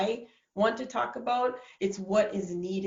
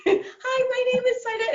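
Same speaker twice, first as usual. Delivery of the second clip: very high, louder mock squeak; hammed-up timid caller, squeaky high; mousy phone voice; 5 dB louder than usual. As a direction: imitate a scared little mouse pitching on the phone. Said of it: "I literally sound like a mouse on the phone".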